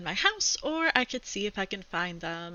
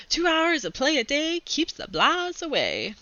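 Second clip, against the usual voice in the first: higher, slower, sing-song upbeat dad; cheery dad; 5 semitones higher, 4 dB louder than usual. Acted. Dad reciting his playground slogan to the family, higher and slower, sing-song. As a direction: chirp it out like a salesman with a slogan. Dad's cheerful motto voiced.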